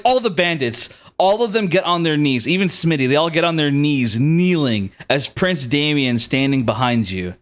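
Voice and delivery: ordinary delivery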